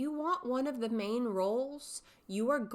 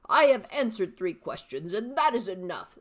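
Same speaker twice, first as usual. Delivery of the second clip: put-on loud, firm old man; old father, fed up; 8 dB louder than usual. Shown as a demonstration. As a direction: put your foot down with your son. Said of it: "three distinct character voices".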